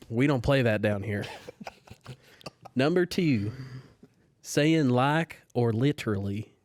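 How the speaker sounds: folksy Southern church lady listing sins; Southern accent. Pretend. Closing statement for the podcast game in a Southern accent. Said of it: "You have to do it in a Southern accent".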